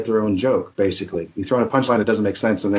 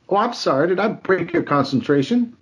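high sarcastic movie-villain line; Pulp Fiction quote; 8 semitones higher than usual. Retold or imitated. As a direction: menace behind a sweet apology. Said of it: "it was a line from".

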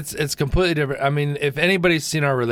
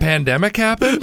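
ordinary talk, nothing marked

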